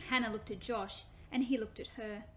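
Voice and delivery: ordinary delivery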